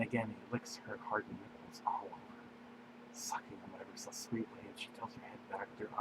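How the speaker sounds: soft unvoiced narration whisper. A narrator's reading. Narrator hushing the intimate moment, whispering. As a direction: drop to a hush.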